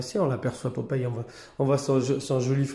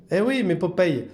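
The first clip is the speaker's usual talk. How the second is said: high pet talk; 7 semitones higher, 3 dB louder than usual. Real, not acted. Telling the cat off sweetly.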